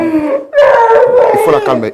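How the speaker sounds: high, loud, slow howl; wailing scream. Real, not acted. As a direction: she screams through the pleas. [name] hurle par-dessus. (hurlement aigu, fort et lent)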